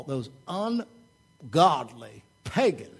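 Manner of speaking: put-on slow, drawn-out Southern accent. Extreme believer condemning outsiders, slow Southern accent. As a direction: stretch the words in a Southern preacher's drawl. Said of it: "it's a Southern accent".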